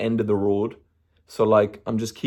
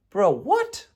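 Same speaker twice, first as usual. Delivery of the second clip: pitched-up disbelieving bro what; incredulous; 8 semitones higher than usual. Retold or imitated. Runner scoffing at the shop's price, higher and incredulous. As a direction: throw it out in mock disbelief.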